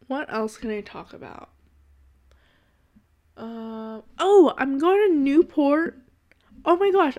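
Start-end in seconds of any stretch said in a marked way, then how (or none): 4.2-7.2 sudden loud burst of joy, pitch jumping up; excited. Real, not acted. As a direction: blurt it out thrilled. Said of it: "I'm so excited".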